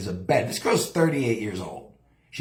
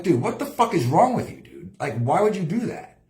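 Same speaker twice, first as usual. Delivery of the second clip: angry; 6 semitones higher than usual. Retold or imitated. Angry call to friend Jetson.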